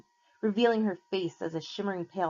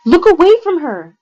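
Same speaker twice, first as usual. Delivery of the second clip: full-throated warning yell, much louder; shouted; 11 semitones higher, 18 dB louder than usual. A narrator's reading. Annabeth warning her friends with a loud shout. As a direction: scream the warning at him. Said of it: "Annabeth shouted".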